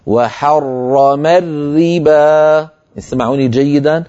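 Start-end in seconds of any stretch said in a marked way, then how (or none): 0.1-2.7 very slow chant, thick r in حرم, thin r in الربا; drawn-out recitation contrasting both r sounds of وحرم الربا; heavy then light r. Shown as a demonstration. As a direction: hold each doubled r, dark in حرم, light in الربا. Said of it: "ففخموا المفخم ورققوا المرقق".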